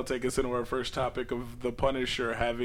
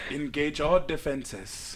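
mock T'Challa African accent; much slower than usual.

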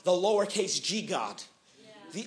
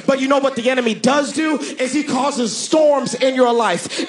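loud, driving pulpit crescendo; thundering sermon, hammering each phrase; full-force preaching; 8 semitones higher, 9 dB louder than usual. Real, not acted. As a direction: preach defiance straight at the devil.

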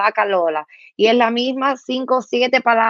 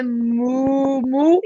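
drawn-out, high double moo; cow moo; 3 semitones higher than usual. Shown as a demonstration. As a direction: show a child how the cow moos. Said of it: "mira, la vaca hace". (mugido de vaca alargado)

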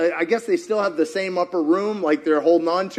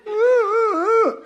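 high laugh; 14 semitones higher than usual. Real, not acted. Laugh after quote.

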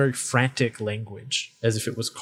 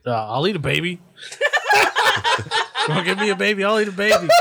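higher, excitable comic bag voice; rising to a loud, laughing protest; eager talking bag; 8 semitones higher, 4 dB louder, much faster than usual. Acted. Game master plays talking bag.

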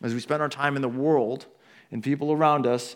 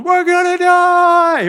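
high, drawn-out mock scream; panicked scream; 12 dB louder, much slower than usual. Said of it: "they're screaming".

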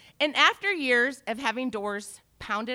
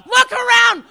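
loud, high exasperated yelling; furious shouted lecture; ranting shout; 11 semitones higher, 10 dB louder than usual. Retold or imitated. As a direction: shout her down. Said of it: "And I was like".

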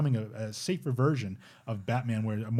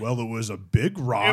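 retold quote; slower than usual. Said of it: "He's like".

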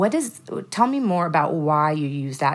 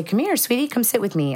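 a lightly voiced tender mother, pitched up; tender invitation; 7 semitones higher than usual. Mother inviting her calmed daughter close, lightly tender. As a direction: coax her gently onto your lap.

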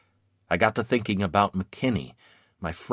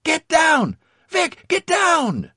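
high, loud warning shouts, slowing on the repeat; urgent yelling; 6 dB louder, slower than usual. A narrator's reading. Comrades warning Vic under fire, yelling. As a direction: yell for him to take cover. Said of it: "and others took it up".

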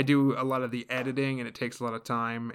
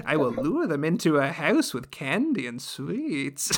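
slow, swooping witch voice; hammed-up storybook witch, stretching the words; fairy-tale witch; 3 semitones higher than usual. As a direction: lure them in like a pantomime witch. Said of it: "Is this the witch from Hansel and Gretel?".

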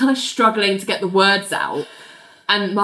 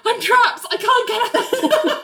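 high, drawn-out wail for help; hammed-up trapped plea, pitched way up; mock panicked cry; 14 semitones higher, slower than usual. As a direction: cry out high, close to laughing. Acting out trapped Georgia.

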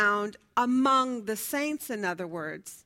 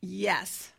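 usual speaking voice

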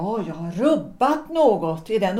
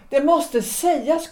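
much higher, pressing the point; firm insistence; 8 semitones higher, 4 dB louder than usual. Acted. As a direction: hold your ground, pitch it up. Wife insists it must be said.

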